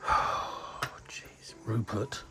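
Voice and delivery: slow, soft whisper of despair; exasperated whisper. Real, not acted. Father despairing at son Rupert, whispering slowly. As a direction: sigh the name out under your breath.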